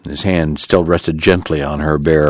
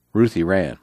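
plain reading voice

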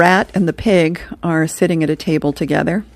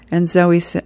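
ordinary talk, nothing marked

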